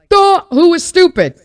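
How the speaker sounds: loud, high mock "duh"; hammed-up self-mockery. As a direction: scoff at your past self, loudly. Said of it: "hindsight's like".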